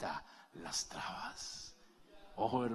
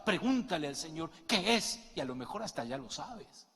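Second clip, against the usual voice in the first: ordinary delivery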